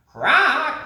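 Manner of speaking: sharp, high bird squawk, loud; high parrot squawk. Acted. Parrot greeting the sleepy creatures with a squawk. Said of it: "Papagayo laughed".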